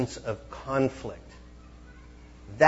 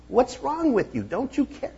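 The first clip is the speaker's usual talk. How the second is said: exasperated plea; 3 dB louder than usual. Retold or imitated. Disciples rebuking sleeping Jesus.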